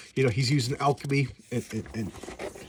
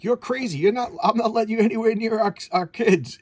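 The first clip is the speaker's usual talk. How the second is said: much higher, louder outraged protest; outraged parent; 9 semitones higher, 5 dB louder than usual. Retold or imitated. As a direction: push back hard like a furious parent. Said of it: "he's like".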